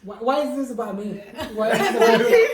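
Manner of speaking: high, loud, laughing complaint; laughing protest. Real, not acted. Teased, protests being singled out.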